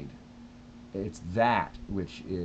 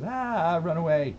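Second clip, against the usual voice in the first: much higher, mock panicked cry; lizard brain panic; 10 semitones higher, 6 dB louder, slower than usual. Lizard brain urging flight, high mock panic. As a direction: play the scared inner voice. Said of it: "my lizard brain creeps in and goes".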